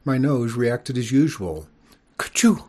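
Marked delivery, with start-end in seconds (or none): 2.2-2.6 loud high sneeze; a big sudden sneeze. A narrator's reading. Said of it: "it said".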